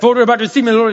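put-on loud, high, hurried child's grace; rapid-fire prayer. Hungry son rushing through grace before the meal, rapid-fire. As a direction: imitate a hungry boy racing through prayer. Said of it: "get to the prayer very quickly".

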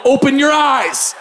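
put-on young believer shouting a healing command. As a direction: order the blind man to open his eyes. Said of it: "and I go".

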